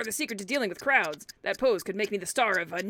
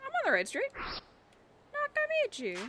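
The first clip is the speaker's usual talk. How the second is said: much higher, squeaky put-on voice; high squeaky confusion; 13 semitones higher, 3 dB quieter than usual. Streamer lost on the street, high squeaky confused voice. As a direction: squeak it like a lost cartoon character.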